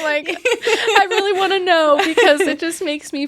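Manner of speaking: high, loud, teary laughing while crying; laughing through tears. Real, not acted. Telling a friend about her son, laughing through tears.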